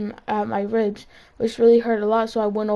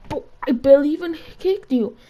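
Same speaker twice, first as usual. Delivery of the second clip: mock disbelief; 5 semitones higher, 3 dB louder than usual. Mock protest to crying kid.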